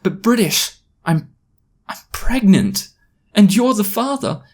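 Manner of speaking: high-pitched, halting mock-tearful plea; tearful confession. Girl confessing pregnancy to British, mock-tearful. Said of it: "My eyes instantly welled with tears".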